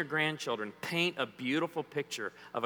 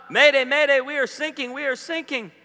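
high, loud mayday call; frantic distress call; panicked sailor; 10 semitones higher, 9 dB louder than usual. Retold or imitated. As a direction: yell it like a sinking sailor. Acting out distress call clip.

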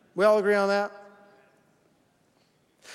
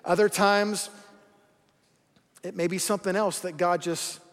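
normal talking voice

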